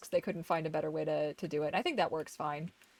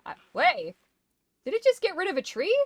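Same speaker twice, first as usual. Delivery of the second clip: sudden high alarmed cry; startled when a tree vanishes; surprised yelp; 11 semitones higher, 9 dB louder, faster than usual. Real, not acted. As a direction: snap out a high startled wait.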